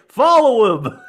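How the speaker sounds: loud, high mock movie shout; imitated command. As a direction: call out the order in a put-on voice. Acting out a film command.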